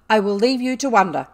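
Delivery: very loud, stern; angry parting shot. A narrator's reading. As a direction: throw it at her in fury. Furious headmistress leaving.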